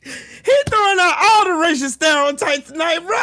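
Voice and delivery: high, incredulous holler on the edge of laughter; real amusement. Real, not acted. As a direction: cry it out, about to crack up. Cracking up at rival's accusations.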